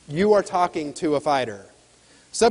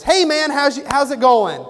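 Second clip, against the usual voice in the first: loud, high, eager greeting; pumped-up salesman; 8 semitones higher, 6 dB louder than usual. Retold or imitated. Mall salesman greeting a customer, loud and eager. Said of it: "The salesperson walks up to me and he says".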